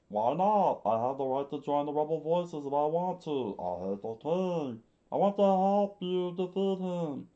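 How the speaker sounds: put-on high defiant boy, restarted take; loud high boy voice. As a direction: insist like a stubborn boy begging to fight. Boy Kappa demands to join.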